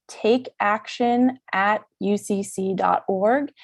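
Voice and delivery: "take action at U C C dot org" slowly, each piece separate; slow, careful enunciation. Shown as a demonstration. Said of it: "I just wanted to really enunciate that".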